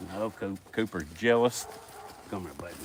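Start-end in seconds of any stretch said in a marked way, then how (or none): none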